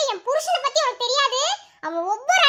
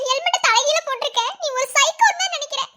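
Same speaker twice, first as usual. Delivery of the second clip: ordinary talk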